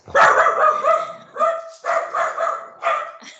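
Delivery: real burst of helpless laughter; loud, high laugh that won't stop; uncontrollable laughter. Real, not acted. Speaker breaks down mid-announcement to colleagues, laughing helplessly. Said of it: "I can't stop laughing".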